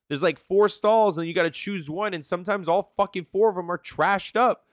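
real worked-up rant, pitched high; mad rant. Real, not acted. Streamer griping to chat about filthy men's stalls, mad. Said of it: "I'm mad right now".

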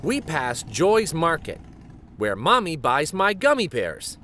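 plain reading voice

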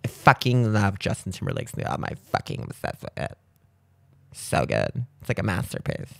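hushed mock fan gushing; mock gushing girl. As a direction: imitate an obsessed fan girl. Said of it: "Girls like".

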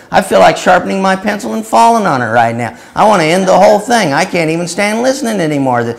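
loud, high, exasperated harangue; a preacher's hammed-up tirade; mock exasperated rant. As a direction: scold him for being dull. Berating the man as boring.

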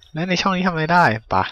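ordinary delivery

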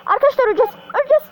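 rising panic, high; panicked. Real, not acted. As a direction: cry out you're doomed. Panic about dying in game.

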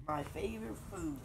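a hint of a hungry monster; lightly voiced gruffalo eyeing its next meal. Gruffalo eyeing up the mouse, lightly voiced monster. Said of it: "the gruffalo said".

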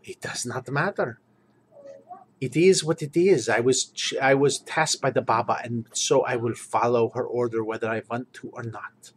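put-on Ratbor in a Slavic accent, resigned; heavy Slavic accent, duty-bound; Slavic-accented guard explaining his orders to the party. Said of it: "Ratbor talks like this, with this accent".

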